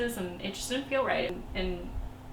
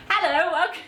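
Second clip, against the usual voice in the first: higher, louder British greeting; pitched-up British customs officer; British accent; 7 semitones higher, 4 dB louder than usual. Acted. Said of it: "Can I do my British accent?".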